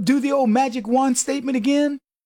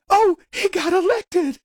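high-pitched mock dismay; mock shock; 8 semitones higher than usual. Host mimics opponents learning he got elected, mock shock. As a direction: exclaim it high, as if horrified.

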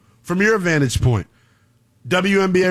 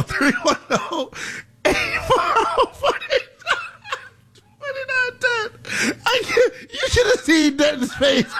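helpless high-pitched laughing speech; 8 semitones higher than usual. Real, not acted. Host cracking up at a colleague's face, helpless laughter. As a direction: squeal the words out through laughter. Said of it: "I'm laughing".

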